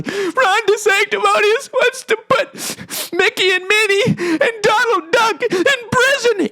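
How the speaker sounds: put-on high, slow weepy kid voice; crying little boy. Crying boy tells Trump about Disney prison, high and weepy. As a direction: sob the complaint like a small child. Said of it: "He knew the nickname".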